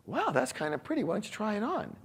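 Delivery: soft, high-pitched re-enacted admiration; gentle encouragement. Husband trying new approach with wife, gently encouraging.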